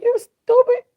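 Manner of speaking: high shrill mock yell of the daughter; daughter's yell. Daughter yelling at her boyfriend, very high and loud. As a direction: squeal it high and loud, indignant. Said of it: "That's what she said".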